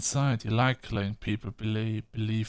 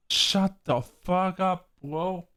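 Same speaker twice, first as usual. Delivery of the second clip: higher, slow, drawn-out shut the fuck up; angry slow snap; 7 semitones higher, much slower than usual. Real, not acted. Fed up with Frank's excuse, slow angry retort. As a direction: spit each word slowly.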